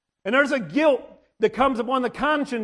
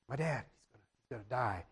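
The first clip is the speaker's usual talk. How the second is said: much lower and far softer; hushed grief; 11 semitones lower, 15 dB quieter than usual. Retold or imitated. Child grieving over his dying dad, hushed and low. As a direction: let it sink into a broken murmur. Child mourning bitten father.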